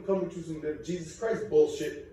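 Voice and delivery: ordinary talk, nothing marked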